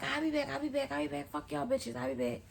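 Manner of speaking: high-pitched rapid chant of a threat; imitated threat. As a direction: mimic her agitated warning. Cousin's repeated threat, retold.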